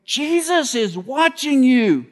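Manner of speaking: high, slow, screechy bird voice; screechy parrot voice. Retold or imitated. Preacher imitating a parrot in a joke, high and screechy. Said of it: "this screechy voice in the back room going".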